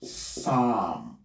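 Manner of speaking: long open vowel in "psalm", no P; silent P. Shown as a demonstration. Teacher repeats the correct word, P silent in "psalm". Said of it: "The word will be".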